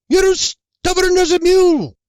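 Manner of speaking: high, loud exasperated shout down the stairs. Acted. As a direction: yell after him, fed up. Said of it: "She shouts at the stairwell".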